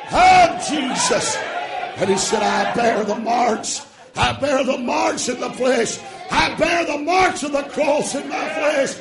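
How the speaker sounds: shouted, high preaching with long stretched words; full-force preaching. Acted. Preacher shouting salvation to his congregation, full-force preaching. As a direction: preach it hard to the church.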